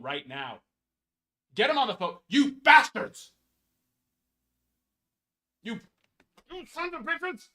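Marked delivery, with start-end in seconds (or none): 1.5-3.3 shouted, higher, hammed-up fury; mock rage. Card collector yelling at Topps in hammed-up fury. 5.6-7.5 mock rage. Continued mock outburst.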